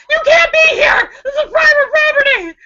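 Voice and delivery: high, loud, frantic shouting; panicked yell. Retold or imitated. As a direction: scream them off your property. Yelling at strangers at door.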